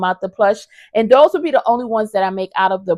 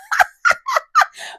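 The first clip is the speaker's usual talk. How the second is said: high delighted giggle; tickled high laugh; squealing high laugh. Real, not acted. Laughs after patch reveal.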